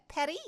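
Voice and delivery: Perry with a tapped, rolled R. Real, not acted. Streamer checking how she said a name, rolled R in Perry. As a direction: roll the R in Perry, rising as a question. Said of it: "Why you roll the R".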